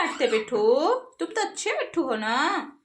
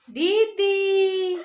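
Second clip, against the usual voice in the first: high, held-out model words; coaxing a parrot; 4 semitones higher, much slower than usual. Shown as a demonstration. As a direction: draw each word out high to be copied. (तोते को सिखाती ऊँची, खिंची आवाज़)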